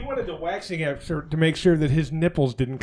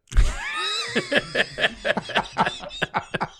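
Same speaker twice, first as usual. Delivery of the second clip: big laugh; 3 dB quieter than usual. Real, not acted. Laughing at own punchline.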